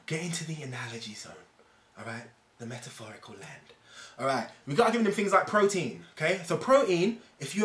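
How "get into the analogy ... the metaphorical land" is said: hushed whispered aside. Acted. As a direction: whisper it like a secret invitation. Playful aside mid-explanation.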